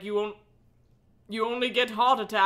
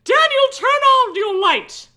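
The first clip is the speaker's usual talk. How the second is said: high, loud call across the house; mom shouting; 13 semitones higher, 10 dB louder than usual. Acted. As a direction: yell it from the next room. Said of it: "my mom shouted from the front room".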